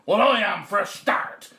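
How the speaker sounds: high, loud retort; scolding wife. A narrator's reading. Wife stops farmer's nonsense, loud retort. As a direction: cut him down firmly. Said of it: "said his wife".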